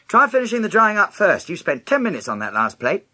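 high, loud, sharp reprimand; snapping. A narrator's reading. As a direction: Mum snapping at her daughter. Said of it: "snapped Mum".

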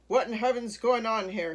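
loud, blustering mayor; crying out. A narrator's reading. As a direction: demand to know, loudly. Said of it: "he cried".

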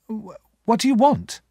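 high, stammered demand; uneasy young Pip. A narrator's reading. Pip questions the man who walked in, uneasy.

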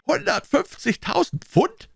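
high, slow, disbelieving echo; incredulous. A narrator's reading. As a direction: repeat the sum as an astonished question. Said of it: "rief er ungläubig".

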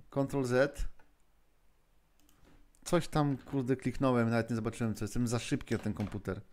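ordinary talk

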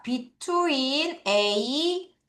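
between A said very slowly for note-taking; dictation pace. Shown as a demonstration. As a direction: say it slowly so they can write it down. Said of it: "필기를 해봐야 되겠습니다". (필기하도록 아주 천천히)

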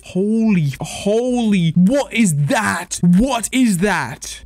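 high, drawn-out amazement; awestruck. Real, not acted. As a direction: stretch each word high in disbelief. Amazed at trading post items.

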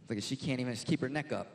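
normal talking voice

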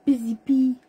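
high, loud singsong pet talk; loud, high baby talk to a pet; doting dog owner. Retold or imitated. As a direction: coo a nickname at the dog, pitched right up. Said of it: "And I'm like".

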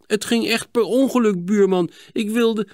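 high-pitched pleading; anxious excuse. Acted. Boy to angry neighbour about the window, pleading. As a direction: plead that you meant no harm.

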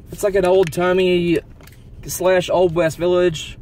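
high, loud, slow amazement; thrilled wonder. Real, not acted. Driver finding an Old West village, loud thrilled wonder. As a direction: let the wonder spill out.

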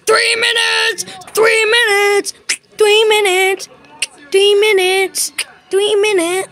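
high, repeated excited yells of three minutes; excited shouting. Real, not acted. Kids counting down to the new year, yelling excitedly. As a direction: shout it out like the countdown is almost done.

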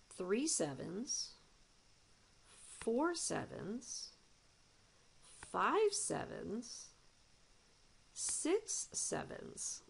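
counting with growing suspense; building count. Shown as a demonstration. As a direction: climb in pitch as the sevens add up. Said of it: "Check that out".

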